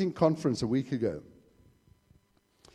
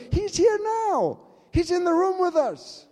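declaration; 12 semitones higher, 4 dB louder than usual. Acted. Preacher declares presence.

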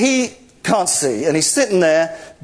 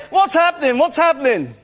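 lightly acted, higher-pitched questioning; raised pitch, prompting the actor; blind man's question; 6 semitones higher than usual. Leader models the blind beggar's line, lightly acted questioning.

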